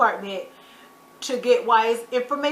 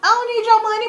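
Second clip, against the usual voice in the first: much higher, loud, defiant shout; furious retort; 12 semitones higher, 5 dB louder than usual. Pretend. Imagining she's Benny, shouting at the uncle. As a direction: tell him off with fury. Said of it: "I'm like".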